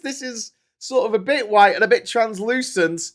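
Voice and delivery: high, loud, appalled tirade; indignant rant. Retold or imitated. Still outraged at the Aussie who served him the tuna sandwich. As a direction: rail at the whole country over mayonnaise. Ranting about Australian mayonnaise.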